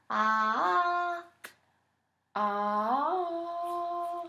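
high, loud sustained ahh; high pitched ahh. Shown as a demonstration. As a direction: raise the pitch on a held ahh. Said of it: "raise your pitch like this".

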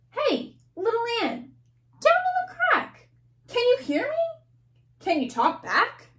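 put-on a big kid taunting a tiny ant; bossy kid. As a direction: tease the ant from above. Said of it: "here's what the ant says back".

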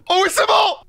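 excited shout. Real, not acted. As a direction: yell it out in triumph. Escaping the monster.

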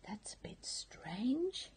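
hushed puzzled whisper; a quiet whispered wonder; whispered thought. Acted. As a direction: whisper it to yourself. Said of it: "he thought".

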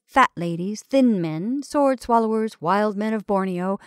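ordinary delivery, nothing marked

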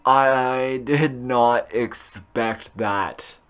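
very slow, word by word; slow emphatic repeat. Real, not acted. Gamer repeating his surprise, very slow and deliberate. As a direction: stretch it out with stunned pauses.

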